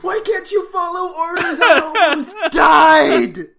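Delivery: hammed-up high, loud rant; furious screaming. Andy beating Barney to a pulp, mock-furious screaming. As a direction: scream it in mock despair, stretching the last word. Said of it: "just screaming".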